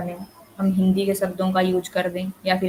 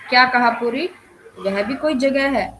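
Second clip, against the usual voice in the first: higher, disbelieving objection; disagreement; 4 semitones higher, 3 dB louder than usual. Shown as a demonstration. Teacher acts out a family member rejecting the holiday place, in disagreement. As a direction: question it in raised-pitch disapproval. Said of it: "इसमें असहमति दर्शाई गई है".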